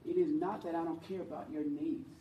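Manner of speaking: ordinary delivery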